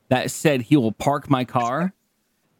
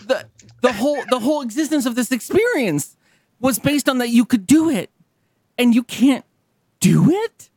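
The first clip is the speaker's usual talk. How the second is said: building outrage; 7 semitones higher than usual. Pretend. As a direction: climb from indignant to near-shout. Escalating rant about the service.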